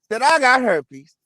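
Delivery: ordinary talk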